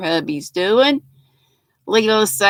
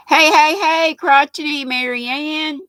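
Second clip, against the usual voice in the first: excited greeting; 7 semitones higher than usual. Real, not acted. Greeting a viewer in chat.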